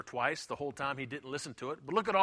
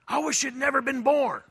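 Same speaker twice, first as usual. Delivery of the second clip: angry parent; 7 semitones higher, 5 dB louder than usual. Retold or imitated. Angry parent voiced in sermon.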